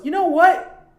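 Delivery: high, loud mock challenge; acted confrontation. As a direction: imitate someone squaring up for a fight. Acts out confrontation in alley.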